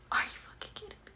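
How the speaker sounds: hushed, incredulous retort; whispered disbelief. Retold or imitated. Woman answering a dismissive stranger, in a disbelieving whisper. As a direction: whisper it as if too stunned to speak up. Retold stunned reply to the stranger.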